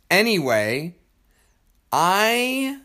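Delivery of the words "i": higher, drawn-out yelled I; raised voice. Real, not acted. Exasperated husband defending his driving, raised voice. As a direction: protest with your voice raised. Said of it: "You're yelling".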